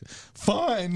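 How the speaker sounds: high, drawn-out mock-indignant "fine"; pissed-off retort. Podcaster retells caving to an online stranger, pissed off. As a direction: give in with exaggerated annoyance. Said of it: "I was, like, pissed".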